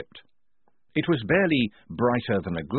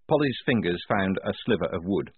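ordinary delivery

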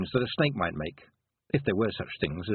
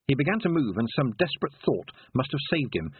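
ordinary delivery

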